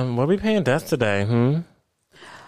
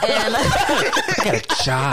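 laughing; 9 semitones higher, much slower than usual. Pretend. Friends echo her line, laughing.

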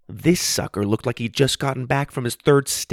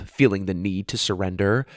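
ordinary delivery

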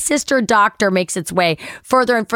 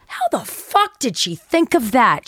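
incredulous, higher voice; mock astonishment; stunned aside; 4 semitones higher than usual. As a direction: marvel at it in disbelief. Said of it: "I'm like".

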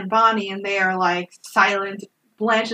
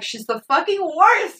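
higher-pitched exasperated complaint, imitated; mock indignation; 7 semitones higher than usual. Bonnie complaining to Clyde about Blanche, mock indignant. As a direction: whine it in mock outrage.

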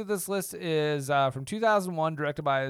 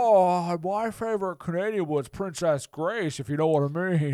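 Jimmy Stewart. Pretend. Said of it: "Jimmy Stewart?".

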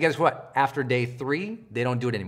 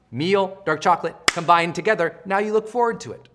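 normal talking voice

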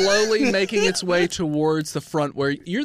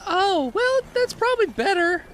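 high innkeeper voice; 14 semitones higher than usual. Acted. Innkeeper women reply.

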